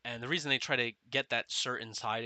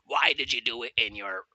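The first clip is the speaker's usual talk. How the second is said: louder scolding yell; 8 dB louder than usual. Retold or imitated. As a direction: chew him out loudly. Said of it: "He was yelling at the recruit".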